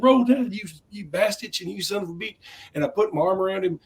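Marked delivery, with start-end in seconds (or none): 0.0-2.4 high rowdy shout fading down; tipsy Roll Tide ribbing. Retold or imitated. Host ribbing a federal judge, high rowdy cheer fading. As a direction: drunk cheer to a stranger.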